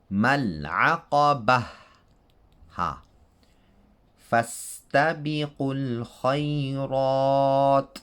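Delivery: تلاوة القرآن; Quranic recitation. Shown as a demonstration. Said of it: "recites examples".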